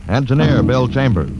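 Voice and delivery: ordinary delivery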